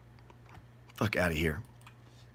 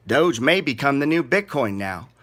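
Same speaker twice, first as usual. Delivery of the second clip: usual speaking voice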